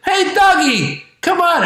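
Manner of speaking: put-on very high kid calling a dog; seven-year-old kid. Seven-year-old coaxing a depressed dog, high-pitched pleading. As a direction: plead brightly like a small child. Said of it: "I'm seven years old".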